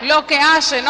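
crying out the words of the cross; loud cry. Shown as a demonstration. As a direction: call it out loud to the whole church. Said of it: "¿No se oye?".

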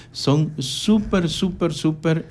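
"súper" estirado y más agudo; súper alargado. Acted. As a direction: repetir "súper, súper, súper" despacio y enfático. Recomendando la clínica con énfasis. (estira cada "súper" para venderlo)

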